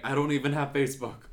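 hammed-up helpless protest; mock freak-out. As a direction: freak out comically in retelling. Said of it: "freaking out".

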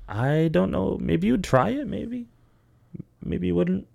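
high, tentative lilt; coy suggestion. Real, not acted. Teasing suggestion to co-host, pitched up.

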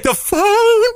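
high-pitched mock hysterical wail; freaking out. Son mimics crying mom explaining to dad, high mock wail. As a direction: squeal it like a distraught mom. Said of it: "freaking out".

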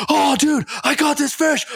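high excited brag; excited friend. Retold or imitated. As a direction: squeal it like he just hooked a monster.

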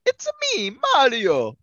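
put-on high, loud Italian-accented Mario catchphrase; cartoon Italian plumber voice; Mario's Italian accent. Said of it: "has an Italian accent".

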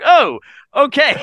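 high, loud startled okay; mock surprise. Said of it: "I'm like".

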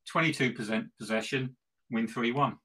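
usual speaking voice

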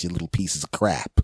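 usual speaking voice

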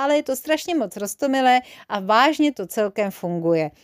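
usual speaking voice

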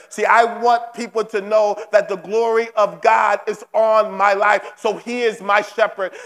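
ordinary talk, nothing marked

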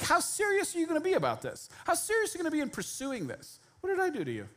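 a hint of a testing sage; playful mentor voice probing a student; mysterious mentor. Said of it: "the Yoda kind of a thing, mysterious mentor".

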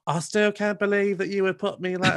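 hammed-up wounded quote; imitating Lawrence Chaney. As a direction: lightly mimic a queen still dwelling on a slight. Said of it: "he was, like, still on that".

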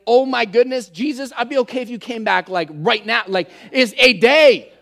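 hammed-up plea, ending loud; acted exasperation. Weary believer begging Jesus to come back, acted exasperation. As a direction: build to a loud, exasperated last word. Acts out an overwhelming week.

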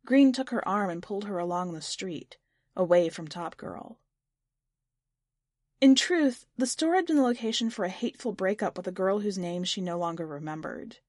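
ordinary delivery, nothing marked

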